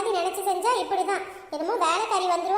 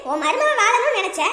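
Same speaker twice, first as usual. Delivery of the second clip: louder, bitter reproach; bitter accusation; 5 semitones higher, 6 dB louder than usual. Acted. Daughter blaming her mother for her ruined marriage, bitter and louder. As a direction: throw it in their faces.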